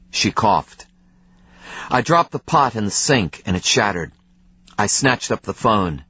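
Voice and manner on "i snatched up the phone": plain reading voice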